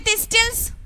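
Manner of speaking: "thistles" as this-tils, T audible; wrongly sounded T. Shown as a demonstration. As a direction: voice the middle T of "thistles". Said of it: "here the T should be silent".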